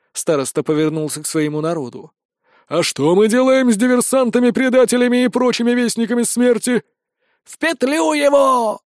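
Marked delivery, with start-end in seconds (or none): 2.7-6.8 high, raised rabble-rousing call; rallying the mob. A narrator's reading. Elder rallies mob against the stranger. 7.4-8.8 high, drawn-out howl for blood; granny's howl. A narrator's reading. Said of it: "взвыла бабка".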